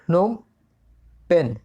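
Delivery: नोम पेन word by word, with a long pause between; pronunciation demo. Shown as a demonstration. Teacher demonstrating the capital's name, नोम पेन in separate words. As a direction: say नोम, pause, then पेन, each on its own. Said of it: "इसका pronunciation आप जो कर लें".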